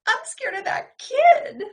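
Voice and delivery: very high, loud squeak; frightened squeak. Acted. Carrie squeaking that the kid scares her. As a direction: squeal the fear.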